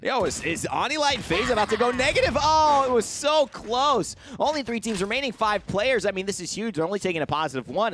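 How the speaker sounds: high, loud excited commentary; esports caster. Real, not acted. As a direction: call the tense finish. Live play-by-play, close finish.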